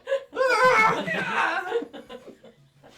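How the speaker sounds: very high, full-volume scream; pained yell. Acted. Game master repeats Auntie's cry for players, pained yell. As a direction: let out a long, loud wounded scream. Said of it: "Yelling out a what?".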